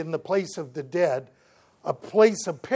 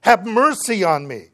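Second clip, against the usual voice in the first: loud, higher-pitched plea; acted cry for help across a distance; 5 semitones higher, 9 dB louder than usual. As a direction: call out loudly for mercy. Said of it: "So he cries out and says".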